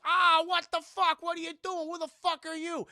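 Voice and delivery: high, loud indignant yelling; startled outrage. Acted. Streamer acts Dick Miller bumped by robot, outraged yell.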